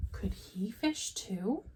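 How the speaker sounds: hushed unvoiced wondering; whisper. A narrator's reading. Reading to kids, whispering George's secret wish. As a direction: breathe the question softly.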